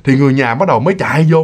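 ordinary talk

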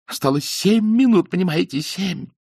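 high, loud, frantic pleading; desperate panic. A narrator's reading. Man begging the crew as time runs out. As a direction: cry out the countdown in terror. Bomb minutes away, panic.

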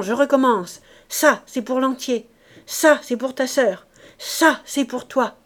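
high, loud chant counting out blows; ferocious glee. A narrator's reading. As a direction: punch each opening word like a strike. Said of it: "prise d'une gaieté féroce". (gaieté féroce, coups scandés)

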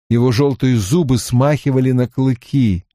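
ordinary delivery, nothing marked

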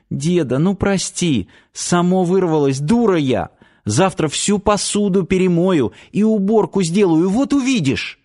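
high-pitched, coaxing granddaughter; pleading apology. A narrator's reading. Girl pleads for grandfather's forgiveness. (виновато упрашивать высоким голоском)

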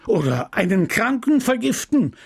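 the pharmacist fuming at his apprentice; a lightly voiced furious old apothecary; angry apothecary. Homais ranting.

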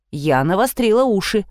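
ordinary delivery, nothing marked

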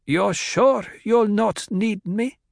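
put-on high, slow voice of the old housekeeper; old maid's voice. A narrator's reading. Mapes asking Jessica if she's needed, high and slow.

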